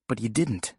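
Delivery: ordinary talk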